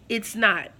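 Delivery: ordinary talk, nothing marked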